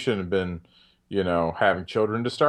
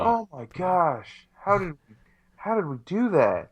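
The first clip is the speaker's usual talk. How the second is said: amazed dismay; 7 semitones higher than usual. Real, not acted. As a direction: marvel at your own blunder, pitched up. Dismayed at their own omission.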